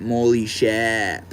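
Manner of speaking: slow Mainer drawl, R dropped from Shap; exaggerated slow Maine pronunciation; Mainer accent. Shown as a demonstration. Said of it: "Saying sharp in a Mainer accent".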